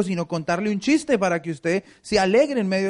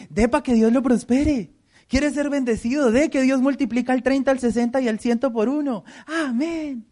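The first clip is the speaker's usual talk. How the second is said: hype preacher; 6 semitones higher than usual. Retold or imitated. Pastor mimics preachers coaxing immature believers, mock hype. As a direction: sell the blessing like a pitchman, pitched up. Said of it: "Toca decirle".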